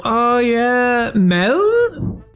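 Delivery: normal talking voice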